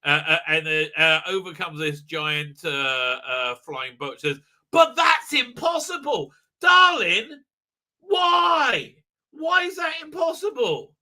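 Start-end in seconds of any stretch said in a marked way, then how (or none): 4.7-10.9 high, loud hammed-up protest; mock exasperation. Reviewer acting out a protest and retort to darling, high mock exasperation. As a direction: stretch it out high and loud in disbelief.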